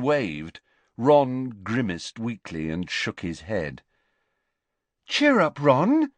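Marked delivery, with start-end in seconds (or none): 5.1-6.1 bright, high, louder encouraging call; cheery call. A narrator's reading. Lavender calling across the table to Ron, cheery. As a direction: cheer a glum teammate on. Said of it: "called Lavender".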